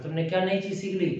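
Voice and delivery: ordinary talk, nothing marked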